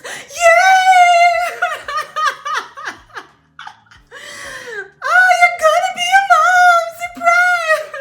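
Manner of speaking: squealing high and loud, thrilled nurse; nurse's cheer. Retold or imitated. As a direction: shout it like an overjoyed nurse. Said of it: "that's what the nurse".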